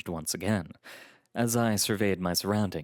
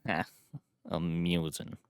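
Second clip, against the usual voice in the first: low, dry acknowledgement; unamused; 4 semitones lower, 4 dB quieter than usual. A narrator's reading. Posh man answers his guest's joke, unamused. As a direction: say it without any amusement. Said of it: "in a tone that suggested he did not find it amusing".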